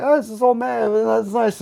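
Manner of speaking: high, airy voice mocking gushing fans; mocking people. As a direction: coo it like a sappy fan. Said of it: "people were like".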